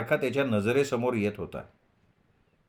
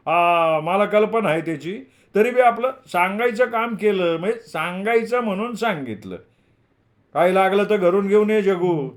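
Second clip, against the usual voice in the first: high, louder elder's voice; obliging landlord; 7 semitones higher, 5 dB louder than usual. A narrator's reading. Landlord offers Jagu things from his house, high and hearty. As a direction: speak up as the accommodating old landlord.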